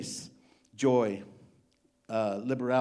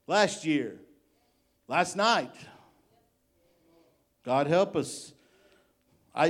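raised, pressing preacher's questions; preaching; 4 semitones higher, 4 dB louder than usual. Acted. Preacher challenging his congregation, raised and urgent. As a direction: press the church with each question.

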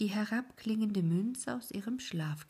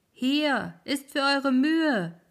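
high, loud, slow sprite voice; the wisps call out mockingly; will-o'-the-wisps calling; 8 semitones higher, 11 dB louder, slower than usual. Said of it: "riefen die Reisenden".

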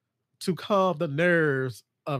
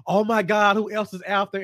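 louder, higher frightened exclaim; alarmed; 6 semitones higher, 3 dB louder than usual. Retold or imitated. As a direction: panic at the twist. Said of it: "It was like".

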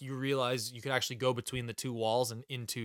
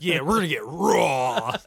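rowdy mock growl; 8 dB louder than usual. Host joking to co-host about explicit content, rowdy. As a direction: whoop it up on the last word.

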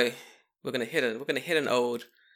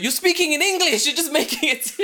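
high, loud mock exasperation; incredulous outburst; 10 dB louder than usual. Man calling out a fake Japanese sentence, incredulous shouting. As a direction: shout it, on the edge of laughter. Acted outburst at the gossipers, nearly laughing.

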